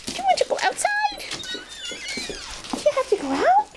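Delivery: high, fast sing-song ask; high excited question. Real, not acted. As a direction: perk the dog up with a high ask. Asking dog, high and fast.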